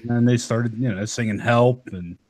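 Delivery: normal talking voice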